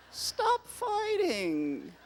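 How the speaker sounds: high drawn-out plea. Acted. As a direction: plead again way up high and slow. Second, higher plea.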